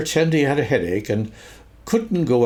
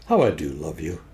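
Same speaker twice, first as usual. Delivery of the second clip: fond; 8 semitones lower than usual. A narrator's reading. Christopher Robin to Pooh after the rescue, fond and tender. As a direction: say it low and tenderly.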